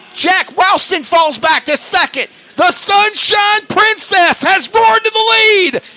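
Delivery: fast rising play-by-play, loud and high; excited race call. Real, not acted. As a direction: call the lead change with rising hype.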